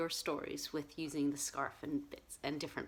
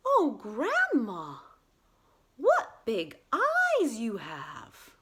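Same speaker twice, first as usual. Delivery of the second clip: loud, slow wide-eyed wonder; 4 semitones higher, 7 dB louder, much slower than usual. Acted. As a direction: stretch out the key words in amazement.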